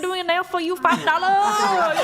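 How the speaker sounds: very high put-on Vietnamese accent; Vietnamese nail salon accent. Nail technician quotes a client the price, mock Vietnamese accent. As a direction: sing out a hammy Vietnamese accent. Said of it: "the nail salon Vietnamese accent".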